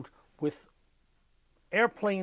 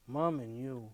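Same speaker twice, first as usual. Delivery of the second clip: ordinary delivery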